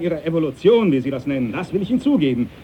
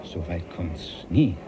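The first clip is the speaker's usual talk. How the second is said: stubborn quiet refusal; 11 semitones lower, 10 dB quieter than usual. Acted. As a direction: dig in, low and unmoved. Captain stubbornly refuses the thought.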